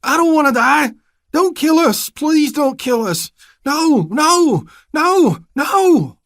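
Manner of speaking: high, loud, pleading screams, slow on please; desperate shrieking. A narrator's reading. Narrator screaming to stop the gunman. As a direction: scream and beg for life. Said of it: "I shrieked desperately".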